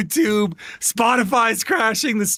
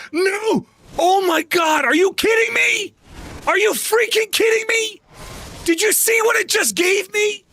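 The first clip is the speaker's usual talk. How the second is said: high-pitched outraged shouting; outraged disbelief; 13 semitones higher than usual. Real, not acted. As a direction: voice shoots way up in furious disbelief. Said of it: "I am so mad right now".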